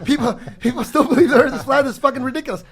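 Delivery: high, loud scoffing disbelief; incredulous. Retold or imitated. Podcaster mocking flat Earth belief to his co-hosts, incredulous. Retells own scoffing reaction, laughing.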